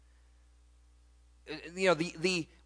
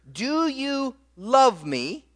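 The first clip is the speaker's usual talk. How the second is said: slow, higher, searching question; voicing Jesus; 7 semitones higher, much slower than usual. Jesus asking Peter about his love, slow and higher. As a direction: ask it slowly, lifting up. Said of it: "and he says".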